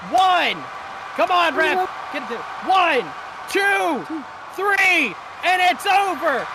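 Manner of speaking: loud, high, hyped pin count; wrestling commentator. Acted. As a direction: shout the count and crown the winners. Pin count and winner announcement.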